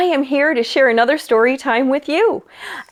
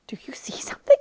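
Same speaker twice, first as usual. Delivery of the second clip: whispered aside; 6 dB quieter, faster than usual. Real, not acted. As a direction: lean in and whisper the wonder. Aside to children.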